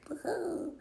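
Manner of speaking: high mock party-guy whoop; goofy woohoo. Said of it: "like he normally is in movies".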